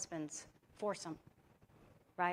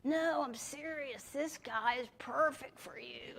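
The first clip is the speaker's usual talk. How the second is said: high, slow, drawn-out put-on voice; Tommy; 5 semitones higher than usual. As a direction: impersonate an excited matchmaker, stretching the words. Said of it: "Tommy, he talked like this".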